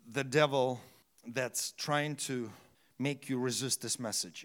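ordinary talk, nothing marked

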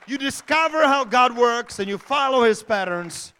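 loud emphatic restatement. Shown as a demonstration. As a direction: drive it home to the church.

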